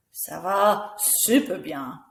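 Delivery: "super" drawn out and emphatic; slow build into a stretched "super bien"; most positive voice. Shown as a demonstration. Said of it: "using a different voice".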